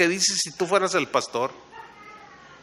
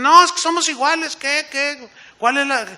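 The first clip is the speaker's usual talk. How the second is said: higher, louder mock protest; protesting objection; 7 semitones higher, 6 dB louder than usual. Church member insisting to the preacher that all are equal, protesting.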